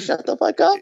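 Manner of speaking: high squeaky parody of a dismissive retort; mocking his friend. Cohost parodying the founder's reaction to being called intimidating, high mock squeak. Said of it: "and then you say".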